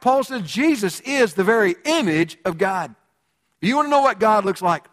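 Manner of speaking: swelling pulpit proclamation; preaching at full force. Acted. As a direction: preach it out to the congregation.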